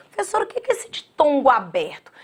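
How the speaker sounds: fast whisper, like a shy pupil; whispered student question. Pretend. Teacher voicing a pupil asking about the rule, whispering.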